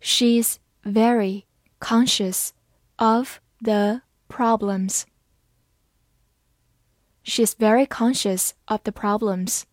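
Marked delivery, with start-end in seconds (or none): 0.0-5.0 slow, careful read-aloud; each word spaced out slowly. Shown as a demonstration. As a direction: read it slowly so learners can follow. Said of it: "好，慢慢来读".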